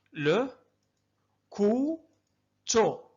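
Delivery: كوتو drawn out very slowly; slow dictation of syllables. Shown as a demonstration. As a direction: stretch كوتو long and slow. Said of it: "أعيدوا".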